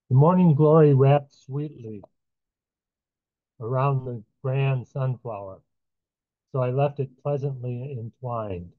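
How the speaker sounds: measured, unhurried reading of a poem with pauses between lines; poetry reading. Acted. Host reading his own poem to the stream audience, measured poetry reading. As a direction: let each line land, reading aloud to a live audience.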